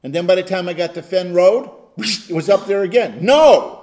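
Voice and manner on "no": a loud sharp no; shouted no. Retold or imitated. Pastor retelling refusing temptation in the car, loud sharp no. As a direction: slam the door on it.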